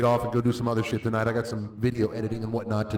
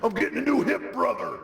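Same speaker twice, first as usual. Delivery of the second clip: high, gruff wrestler shout; Hulk Hogan impression; 7 semitones higher than usual. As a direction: belt it like a wrestling promo. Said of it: "The Hulkster Gets a Hip Replacement".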